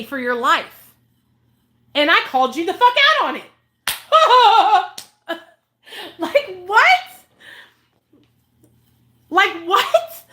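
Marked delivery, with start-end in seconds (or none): 1.9-3.5 loud, fast, higher callout; angry callout. Real, not acted. Calling out Devin Savage to viewers, loud fast and angry. 3.9-5.0 loud high burst of laughter; incredulous laugh. Real, not acted. Laughing at Devin Savage's business, loud high laugh. 6.2-10.2 high, loud "what?" through laughter; baffled, laughing; laughing disbelief. Real, not acted. Reacting to Devin Savage's detox boxes, high laughing disbelief.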